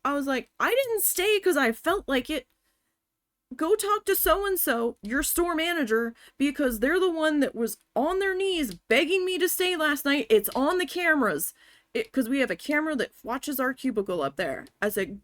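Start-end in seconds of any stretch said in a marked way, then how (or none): 0.6-11.5 high, insistent self-defence; retold indignant comeback. Worker defending herself to the angry manager, retold indignant comeback. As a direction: argue back heatedly with raised pitch.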